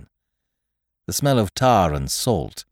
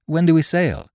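put-on eager boy question; 8 semitones higher, 3 dB louder than usual. A narrator's reading. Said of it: "cried I".

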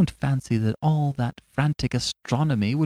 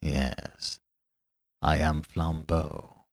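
low, soft and slow confession; 7 semitones lower, 7 dB quieter, much slower than usual. Acted. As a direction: drop deep and slow to reveal yourself. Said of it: "in the same low voice".

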